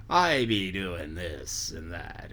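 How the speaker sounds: put-on slow, lightly done West Country pirate accent with rolled R; a hint of the old movie pirate accent, low and drawn out. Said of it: "his accent was all R".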